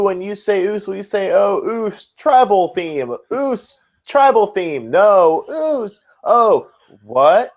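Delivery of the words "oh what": ordinary talk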